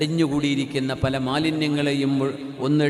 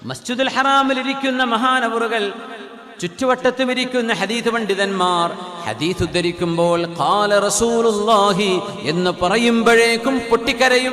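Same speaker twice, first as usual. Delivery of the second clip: raised, sustained sermon voice; impassioned preaching; 7 semitones higher, 6 dB louder than usual. Acted. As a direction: build to a loud, intoned climax.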